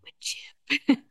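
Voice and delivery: mock-adoring, voiceless whisper; loving whisper. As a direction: whisper "Wood chip" like you love it. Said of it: "say it like you love it".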